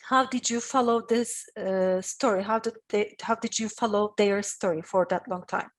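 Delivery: ordinary talk, nothing marked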